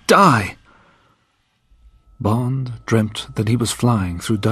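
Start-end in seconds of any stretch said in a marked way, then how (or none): 0.1-0.6 furious outburst at himself; angry shout. A narrator's reading. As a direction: shout it, higher and louder. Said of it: "he told himself angrily".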